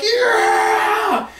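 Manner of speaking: a thrilled skydiving shout; gleeful yell. Retold or imitated. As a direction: cheer while falling through the air. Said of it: "I'm like".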